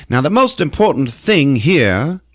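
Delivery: high, emphatic lawman address; put-on official voice, punching key words; Sheriff Heidegger. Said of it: "then we got Sheriff Heidegger".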